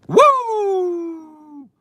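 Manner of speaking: very high, loud whooping; real joyous woo shouts; excited whoops. Real, not acted. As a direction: cheer at the top of the voice. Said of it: "I just got excited".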